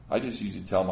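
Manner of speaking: ordinary delivery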